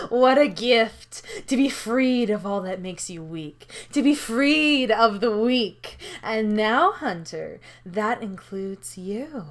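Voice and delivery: slow, savouring gloat; villainous host. Acted. Villain gloats over the hunter.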